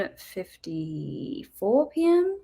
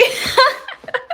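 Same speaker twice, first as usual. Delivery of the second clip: high laugh; 6 dB louder than usual. Real, not acted. After the chant.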